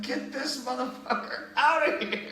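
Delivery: high, drawn-out exasperated imitation; mock freak-out. Imitating host freaking out at drunk intruder, high mock outrage. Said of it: "Mike's freaking out".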